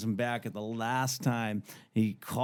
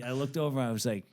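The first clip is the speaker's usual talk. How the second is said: ordinary delivery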